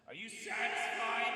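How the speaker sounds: high, distant echoing yell; yelled over cliff. Pretend. Host satisfying a viewer's request, high yell over the cliff. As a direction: call it out far into a canyon. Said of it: "yelled over the cliff".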